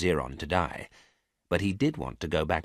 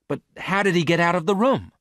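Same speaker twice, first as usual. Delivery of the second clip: alarmed loud question; 10 semitones higher, 6 dB louder than usual. A narrator's reading. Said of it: "asked Miranda".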